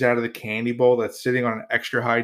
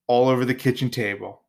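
ordinary talk, nothing marked

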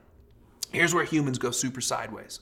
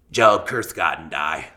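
very low, slow, scornful smoker's voice; Jersey smoker wife; 10 semitones lower, slower than usual. Acted. Scorned wife telling Job to curse God, low smoker voice. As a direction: drag it out low like a bitter, hurt smoker. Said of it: "I hear her saying it like this. She's a smoker".

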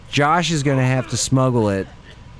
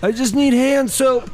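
feigned sick weakness; 8 semitones higher than usual. Pretend. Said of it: "And act weak".

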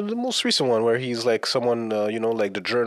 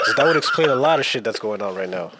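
laughing; 2 semitones higher than usual. Real, not acted. Reacting to the retrograde news, laughing. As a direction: talk through real laughter.

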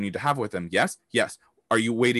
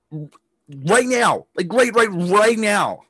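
higher, louder, pressing right now; urgent right now; 10 semitones higher, 6 dB louder, much slower than usual. Real, not acted. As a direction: hammer the urgency home. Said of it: "I'm gonna say that again".

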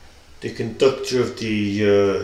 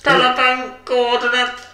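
high-pitched put-on voice; odd musician character; 11 semitones higher, 4 dB louder than usual. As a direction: say it oddly high and stilted.

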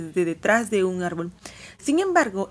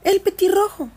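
high, loud call about the robin; Lucy blurts it out, pointing; excited exclamation; 11 semitones higher, 6 dB louder than usual. A narrator's reading. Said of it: "exclamó Lucy". (exclamación emocionada, aguda y fuerte)

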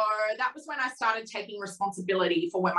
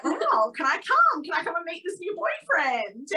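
excited pleading; 8 semitones higher than usual. Retold or imitated. Client excitedly asks to come.

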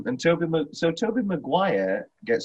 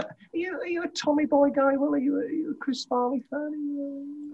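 much higher, soft teasing voice; squeaky teasing question; high put-on voice; 5 dB quieter than usual. Asking Will in a put-on voice.

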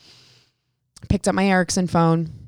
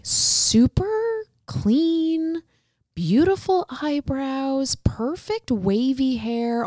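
admiring drawl; 6 semitones higher, much slower than usual. Retold or imitated. Admiring the stylish guy.

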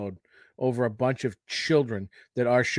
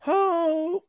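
voice crack. Real, not acted. Host's voice cracking mid-banter, real crack. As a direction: voice cracks high. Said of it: "oh, my voice just cracked".

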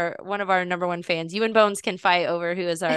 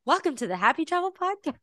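put-on high, softer child's intro; small-girl announcer voice, pitched way up; imitating little girl; 9 semitones higher, 5 dB quieter than usual. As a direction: chirp the show greeting like a tiny host. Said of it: "she just knew, like".

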